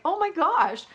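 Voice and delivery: high-pitched acted shock; mock alarm. Acted author's alarmed tweet reply.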